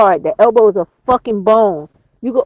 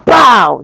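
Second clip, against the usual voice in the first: loud punchy "Bow!" for the strike; punch sound effect; 10 dB louder than usual. Shown as a demonstration. Teaching viewers a throat strike, loud mock impact sound. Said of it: "followed up with a fist or forearm right to the fucking throat".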